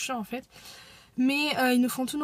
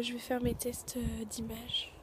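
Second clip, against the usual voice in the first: whispering; 10 dB quieter, slower than usual. Real, not acted. Sitting in the car.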